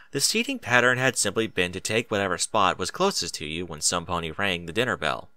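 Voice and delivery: usual speaking voice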